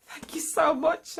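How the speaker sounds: retold overjoyed thanks; grateful. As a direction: gush your thanks. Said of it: "I was just like".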